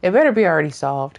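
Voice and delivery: usual speaking voice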